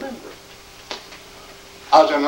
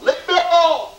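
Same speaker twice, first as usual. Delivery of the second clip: high, acted angry yell; furious rebuff, pitched way up; madder; 13 semitones higher than usual. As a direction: imitate a man at the end of his patience. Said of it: "he got madder by the month".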